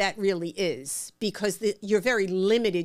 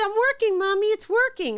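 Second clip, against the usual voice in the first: put-on much higher, louder child voice; excited little girl; 11 semitones higher, 5 dB louder than usual. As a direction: squeal like a five-year-old thrilled with a bike. Five-year-old daughter on the bike.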